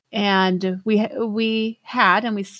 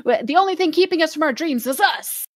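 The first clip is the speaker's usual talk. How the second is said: hyped self-talk, rising higher and quicker toward the end; naive pep talk; 6 semitones higher than usual. Retold or imitated. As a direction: mimic your old overconfident cheerleader self. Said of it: "we were all like".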